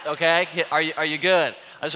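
ordinary talk, nothing marked

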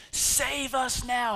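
high, slow pleading call; pleading cry. Acted. Crowd pleading for Jesus to save them, high and slow. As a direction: stretch it out high, begging.

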